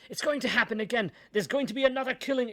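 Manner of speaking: high, loud frantic plea; desperate shouting. A narrator's reading. Suspect yelling at police to free him, frantic shout. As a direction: shout to be released, panicked. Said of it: "the suspect kept shouting".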